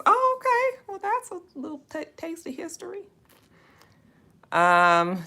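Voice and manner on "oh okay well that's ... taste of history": surprised. Real, not acted. Host learns the book's places are real, surprised high squeal. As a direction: caught off guard, voice jumps way up.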